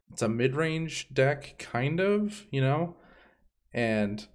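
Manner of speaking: usual speaking voice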